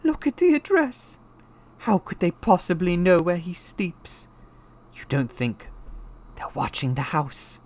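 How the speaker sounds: high, fearful wife; quivering voice. A narrator's reading. Wife afraid the house is watched, quivering voice. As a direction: worry aloud in a high quiver. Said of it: "saying in a quivering voice".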